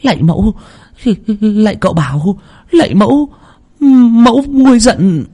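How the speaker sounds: high, pleading chant to the goddess; frantic prayer. Acted. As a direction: beg the spirit to calm down. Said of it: "Họ bắt đầu chắp tay khấn".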